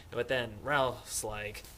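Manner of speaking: ordinary talk, nothing marked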